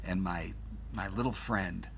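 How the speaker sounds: put-on low Chicago movie-gangster accent; Untouchables accent. Host showing coworker the accent, in a low Untouchables Chicago voice. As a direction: play an Untouchables tough, voice dropped low. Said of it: "sort of my Untouchables accent".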